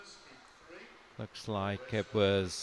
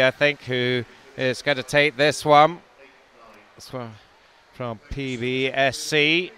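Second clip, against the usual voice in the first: louder excited play-by-play; live race call; 3 semitones higher, 8 dB louder than usual. Acted. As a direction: call the race home, lifting the pitch.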